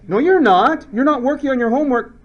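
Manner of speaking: mock-exasperated teacher scolding a student who sits idle. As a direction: scold the class slacker.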